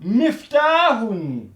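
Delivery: "Miftahun" drawn out, slow; slow model word. Shown as a demonstration. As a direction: model "Miftahun" slowly for the class. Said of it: "Regardez très bien". (« Miftahun » articulé lentement)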